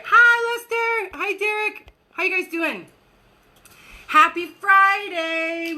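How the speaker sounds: high, bright hellos to viewers; cheerful greetings. Real, not acted. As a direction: greet each viewer gladly.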